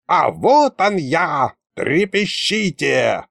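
slow, drawn-out grand entrance; creaky menacing ghost. A narrator's reading. Ghost appearing to the group, slow menace. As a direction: announce yourself with creaky menace. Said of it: "злорадный скрипучий голос".

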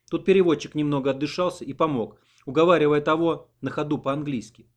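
plain reading voice

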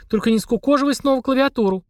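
high-pitched alarmed plea; frightened. A narrator's reading. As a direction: plead in alarm, pitch jumping up. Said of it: "испугалась Полина".

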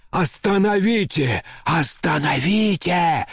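high, drawn-out frantic plea, voiced; frantic whisper. A narrator's reading. General begging the narrator to stop her, frantic and high. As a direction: beg urgently in a strained stage whisper. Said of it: "зашептал мне генерал в выступлении".

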